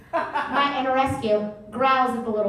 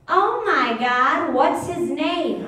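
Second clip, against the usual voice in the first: slow, higher, louder mock gush; 4 semitones higher, 4 dB louder, much slower than usual. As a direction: throw her own gushing back at her. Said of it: "I'm gonna go".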